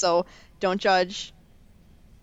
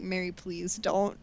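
weary pleading; 2 semitones lower, 6 dB quieter than usual. Retold or imitated. Grandpa begging his daughter-in-law to stop, weary pleading. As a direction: plead softly, worn down. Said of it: "Oh, he sounds so sad about it".

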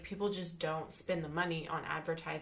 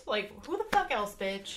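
sassy retort; 4 semitones higher, 4 dB louder than usual. Retold or imitated. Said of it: "I'm like".

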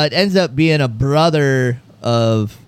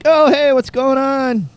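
slow, much higher mock-cheery hello; fake friendly greeting; 12 semitones higher, slower than usual. Greeting the stranger, faking recognition in a high cheery voice. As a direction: pretend to know him, all sunshine. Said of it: "I'm like".

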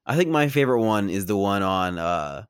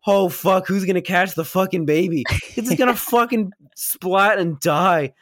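high-pitched panicked reenactment; freaking out; 10 semitones higher, 4 dB louder than usual. Retold or imitated. Film fan reliving the stroller flying through the air, mock panic. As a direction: raise the pitch, play up the viewer's alarm. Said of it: "I was freaking out too".

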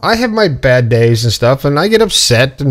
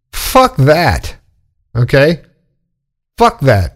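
ordinary talk, nothing marked

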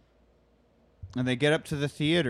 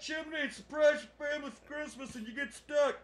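stuck dad voice; 10 semitones higher, 4 dB louder than usual. Retold or imitated. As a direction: play a trapped dad calmly pitching a hypothetical. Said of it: "he's like".

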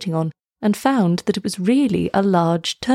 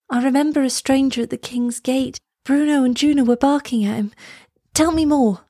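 put-on higher girl's voice; curious girl; 6 semitones higher than usual. A narrator's reading. Elsa recalls the stranger at the gate, curious and higher. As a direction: press for more in a higher voice.

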